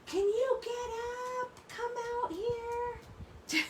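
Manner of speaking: high, slow, drawn-out calling; imitated yelling. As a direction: mimic a little boy hollering at someone asleep. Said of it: "He just kept yelling at the car".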